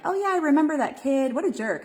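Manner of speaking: put-on bright, loud, high, amused brush-off of an old memory; mock-amused "what a jerk"; amused dismissal. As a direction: toss it off with a laugh in the voice. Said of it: "and you kind of laugh about it".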